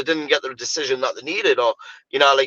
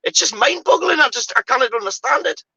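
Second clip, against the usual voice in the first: disbelief; 9 semitones higher than usual. Real, not acted. Disbelief at players.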